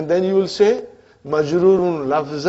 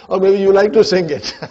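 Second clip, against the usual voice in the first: laughing; 4 dB louder than usual. Real, not acted. Teacher laughs about the song.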